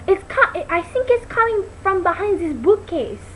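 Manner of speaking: a touch of Inga's voice. Retold or imitated. Girl telling her fans about Inga's line, lightly voiced. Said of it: "And Inga goes".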